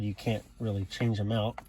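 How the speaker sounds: ordinary delivery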